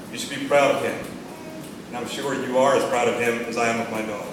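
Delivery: normal talking voice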